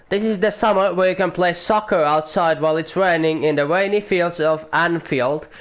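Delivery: put-on a clumsy stab at a London accent; bad London accent. Commentator joking about British weather in a bad London accent. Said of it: "that was a very terrible London accent".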